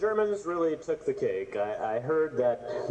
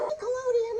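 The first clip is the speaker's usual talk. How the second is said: much higher, excited cry of Nickelodeon; excited exclamation. Real, not acted. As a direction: call out Nickelodeon in delighted disbelief. Shocked at German cover.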